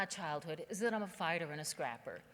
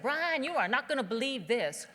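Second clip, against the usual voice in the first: loud, delighted telling, higher; gleeful phone call; 4 semitones higher, 8 dB louder than usual. Retold or imitated. Calling his brother about rich people, gleeful and loud. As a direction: crow the news to your brother. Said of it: "So I called my brother and said".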